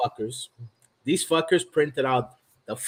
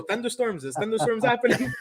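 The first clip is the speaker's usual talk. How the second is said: higher, teasing weatherman voice; lightly voiced weather report; mock forecaster; 7 semitones higher than usual. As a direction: taunt the weather guy. Mocking the weatherman.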